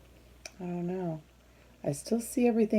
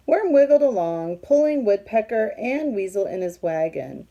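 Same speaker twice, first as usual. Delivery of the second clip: loud, lively storybook read-aloud; reading to children; 3 semitones higher, 6 dB louder than usual. A narrator's reading. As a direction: read it out big for the kids. Reading picture book aloud.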